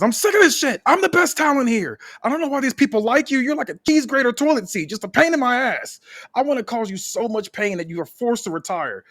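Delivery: a villain's ranting meltdown; angry rant. Acted. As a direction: spit out every hate like a sore loser. Heel wrestler's angry rant.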